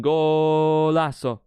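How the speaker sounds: long, loud held "Golazo"; drawn-out goal cry. Real, not acted. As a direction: stretch the vowel out like a football commentator. Celebrates the goal.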